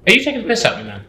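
high-pitched mock outrage; mock offended. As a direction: play up being insulted, jokingly. Mock offended at friend's joke.

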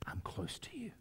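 soft whisper as God to Elijah; hushed, unhurried promise; whispered reassurance. Acted. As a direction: whisper it close and intimate. God's whisper to Elijah, voiced.

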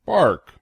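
loud high yappy woof; mock bark. Reader barks for the dog lovers, loud and playful. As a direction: bark it out like an eager puppy. Dog sound after dogs line.

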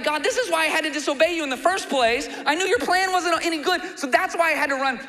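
high, loud, whining blame; mock whiny Jonah. Preacher mocking Jonah blaming God, high whiny voice. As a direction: whine like a sulking prophet blaming God. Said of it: "He's like".

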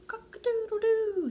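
high, loud rooster crow. Pretend. Reading to a child, crowing like a rooster. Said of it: "He can sound like a rooster".

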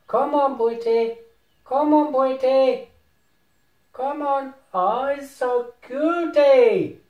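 put-on very high, drawn-out baby talk; cooing pet call. Player calling a cute virtual pet, cooing. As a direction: coo and coax as if to a puppy.

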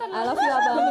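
high, sugary mock-lover voice; mock sweetheart. Friend hamming up a Valentine declaration, high sugary coo. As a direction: coo it like a lovestruck girlfriend.

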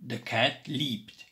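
usual speaking voice

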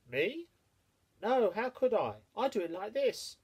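high, quick little hare voice. Acted. The hare answering the mole, high and lively. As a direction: pitch it up like a nimble hare.

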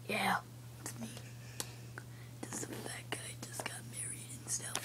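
quick, sneaky whispered aside. Pretend. Toy whispering a rude joke about old Chaz, in a fast whisper. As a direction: whisper it fast behind his back.

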